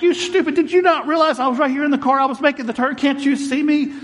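high yelling at the other driver; hollering high in the car; mock road rage. Preacher yelling at honking driver, mock rage. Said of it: "I'm like".

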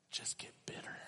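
breathy whisper of a confession. Real, not acted. Preacher confessing his bitterness to the church, whispered. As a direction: let the words drop to a whisper.

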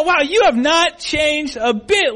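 high, slow, emphatic mock amazement; backhanded compliment. To someone from high school, pointedly unflattering. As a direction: stretch it out as an incredulous dig. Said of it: "it really wasn't a compliment".

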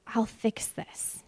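slow, breathy whisper of God's promise; soft, slow whisper. Acted. Preacher voicing God to wrecked Isaiah, slow soft whisper. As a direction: whisper it gently, slowly, as a promise.

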